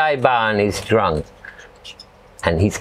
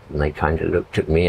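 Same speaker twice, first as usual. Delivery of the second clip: ordinary talk, nothing marked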